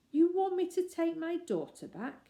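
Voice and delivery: can't believe his ears; incredulous. Acted. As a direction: actor repeating the bear's demand in shock. Actor incredulous.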